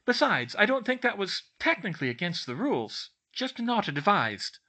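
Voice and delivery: high, prim schoolgirl voice; defensive bookish girl. A narrator's reading. Girl defends her prank to a friend, defensive. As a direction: argue the technicality in a high voice.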